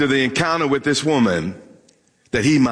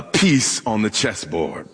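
usual speaking voice

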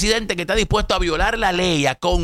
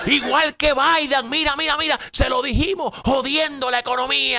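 higher, whiny hammed-up griping; mock partisan complaint; 5 semitones higher than usual. Commentator parodying voters blaming Biden. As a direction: imitate the opponents complaining. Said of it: "Eso es lo que estuvieran diciendo ustedes".

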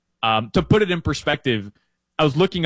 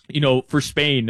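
usual speaking voice

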